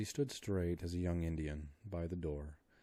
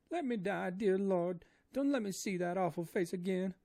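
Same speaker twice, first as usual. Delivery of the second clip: high, loud wailing maid voice; terrified woman begging to die, high wailing; crying Esmeralda; 13 semitones higher, 7 dB louder than usual. A narrator's reading. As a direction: wail in despair with eyes shut. Esmeralda's voice held.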